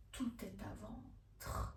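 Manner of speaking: slow, near-whispered reply; hushed retort. A narrator's reading. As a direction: murmur it slowly under the breath. Woman's reply cut short, unvoiced. (réplique lente, presque chuchotée)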